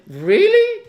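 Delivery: high, loud, doubting "Really?"; shocked and doubtful, pitch jumping up; suspicious really. Retold or imitated. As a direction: retell a stranger's suspicious disbelief. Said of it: "They get suspicious".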